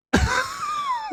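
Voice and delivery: a high squealing burst of laughter; shrieking laugh. Real, not acted. Shocked by the game's intro.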